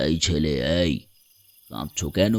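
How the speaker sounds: very low, soft man's voice; mysterious stranger. Acted. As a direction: call softly and deeply out of the dark. Stranger calls out in dark. (নিচু, গম্ভীর আগন্তুকের গলা)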